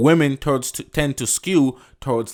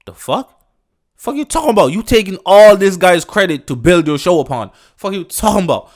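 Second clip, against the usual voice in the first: loud, high furious outburst; angry shout; 7 semitones higher, 9 dB louder than usual. Real, not acted. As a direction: blast the comment back, loud and high. Angry rant at sexist comment.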